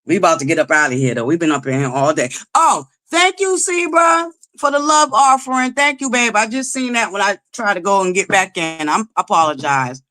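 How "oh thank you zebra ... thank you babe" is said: excited. Real, not acted. Thanking a donor on stream.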